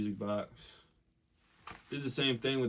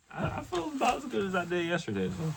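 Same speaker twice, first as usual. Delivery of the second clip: voice crack; 11 semitones higher, 4 dB louder than usual. Real, not acted. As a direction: voice cracking up high. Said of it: "that voice crack".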